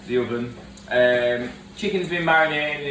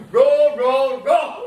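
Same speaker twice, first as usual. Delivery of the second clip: high, loud angry chef shouts; Gordon Ramsay; 11 semitones higher, 8 dB louder, much slower than usual. Retold or imitated. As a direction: yell it like a furious TV chef. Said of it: "a little Gordon Ramsay moment".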